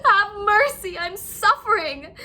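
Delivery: high, crying plea, slowing down; wailing. A narrator's reading. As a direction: beg through tears. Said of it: "the fox wailed".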